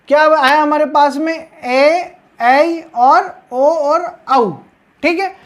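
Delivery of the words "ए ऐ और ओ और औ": slow classroom vowel demo; drawn-out vowels. Shown as a demonstration. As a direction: say each vowel long and slow. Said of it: "इन दोनों का उच्चारण कहाँ से होगा".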